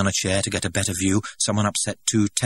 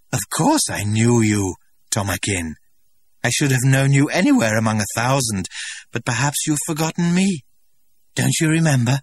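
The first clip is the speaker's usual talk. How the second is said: higher, louder, eager and coaxing; Linda's pleading; 7 semitones higher, 7 dB louder than usual. A narrator's reading. Linda urging the director to recognise her, pleading. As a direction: reach out to him with hope. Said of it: "Linda turning to the director".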